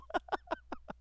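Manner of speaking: squeaky surprised giggle; a burst of high laughter. Real, not acted. Viewer giggling at a clip. Reacting to video.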